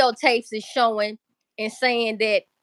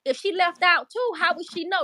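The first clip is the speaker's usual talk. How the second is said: higher, puzzled self-questioning; asking herself in disbelief; baffled; 7 semitones higher than usual. Retold or imitated. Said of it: "I kept asking myself".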